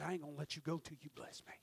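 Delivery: hushed, breathy whisper. Acted. Preacher whispering Jacob's vow to the congregation. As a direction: draw them in with a whisper.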